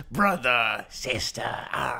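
lightly voiced cartoon rat greeting, fading to a breath; mock a cringy secret handshake; a hint of cheesy camaraderie. Host mocking cartoon rats with a lightly voiced fond greeting. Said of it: "it was just so weird that it was like".

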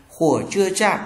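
"chạm" given the weight in "Khủa chưa chạm"; teacher's model with the accent on "chạm"; stress on last syllable. Shown as a demonstration. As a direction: lean on the final syllable "chạm". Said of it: "nhấn vào âm tiết cuối cùng".

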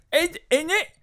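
high, exaggerated British accent; extra British. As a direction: pitch up a hammy British tag question. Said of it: "British people are being extra British".

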